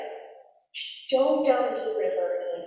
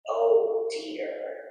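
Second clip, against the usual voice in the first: usual speaking voice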